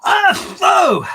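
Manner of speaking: loud, high, explosive fake sneeze; big "ah-choo" blasted out openly; mock sneeze. Man deliberately sneezing his cold at the police, loud mock sneeze. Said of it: "So I went".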